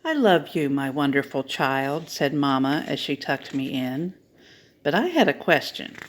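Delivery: lively storybook reading, voices swinging high; animated picture-book reading for a children's story time. Acted. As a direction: read it like a bedtime book for little ones.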